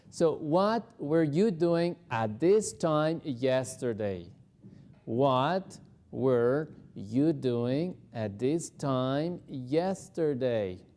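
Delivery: slow, every word clear; slow repeat-after-me drill; slow practice drill. Shown as a demonstration. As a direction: say it slowly for repeating. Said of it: "vamos a practicar esta pregunta".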